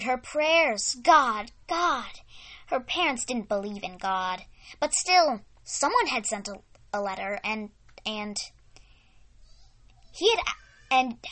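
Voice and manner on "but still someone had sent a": usual speaking voice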